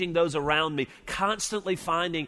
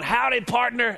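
higher, louder mock Texan howdy; Texan greeting; 7 semitones higher, 4 dB louder than usual. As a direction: play the hearty Texan oilman in boots and bolo tie. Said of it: "he'd walk through town".

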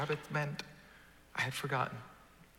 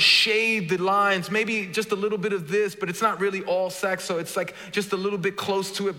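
loud, higher-pitched sermon delivery, building; full-force preaching; 6 semitones higher, 9 dB louder than usual. Real, not acted. Preacher urging the congregation that God satisfies, loud impassioned preaching. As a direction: preach it at full volume, rising to a peak.

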